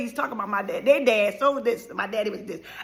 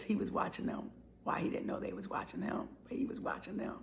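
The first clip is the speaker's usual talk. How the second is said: softer, unvoiced whisper; 4 semitones lower, 14 dB quieter than usual. Real, not acted. Revealing dad watched his kids, a whisper. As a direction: lean in and whisper the secret.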